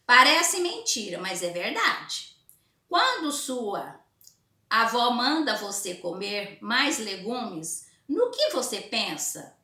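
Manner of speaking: put-on slow, emphatic reading to children; story read-aloud. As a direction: read it out word by word for pupils. Reads comic aloud to pupils.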